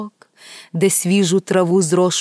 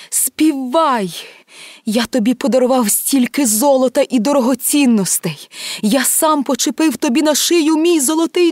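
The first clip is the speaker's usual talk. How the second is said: desperate command; 8 semitones higher, 4 dB louder than usual. Acted. Emperor begs the golden bird to sing, building desperation. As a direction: build from urgent plea to loud, long wail.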